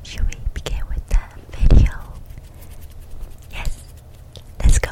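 breathy close whisper; ASMR whisper. Real, not acted. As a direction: whisper right into the mic.

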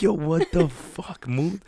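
freaking out. Retold or imitated. Gushing to the groom over the gorgeous ring, slow stunned awe. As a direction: drag the words out in disbelief. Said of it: "I start, like, freaking out".

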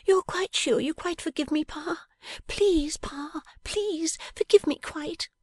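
a playful wife begging her Pa; pleading Bella. Acted. Bella begs forgiveness.

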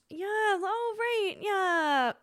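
high, airy mock-polite brush-off; breezy fake-nice reply to an ex. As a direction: sound interested while clearly not caring. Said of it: "voin-, tone".

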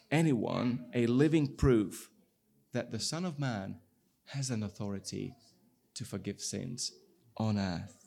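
normal talking voice

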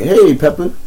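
high sing-song pet call; bright pet-calling voice; calling the dog. Retold or imitated.

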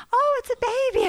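put-on very high, sweet child-like coo; cooing kids. Kids finding a baby mouse, imitated high cooing. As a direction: imitate kids melting over something tiny.

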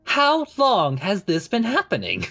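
high, rising incredulous question. Acted. Master asking servant about the Hydra, incredulous. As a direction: ask it high, in theatrical disbelief.

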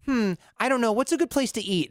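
high, hesitant, whiny indecision; mock dithering tourist. Tourist dithering to a New Yorker, mock whiny and high. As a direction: play a fussy, undecided visitor.

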